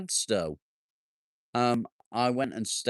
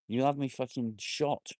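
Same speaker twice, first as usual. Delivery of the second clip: ordinary delivery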